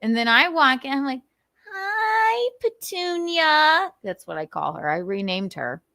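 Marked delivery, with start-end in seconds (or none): 1.7-3.9 high, drawn-out cutesy hello; sing-song pet greeting. Retold or imitated. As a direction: coo slowly in a high voice at a cat. Acting out greeting the cat.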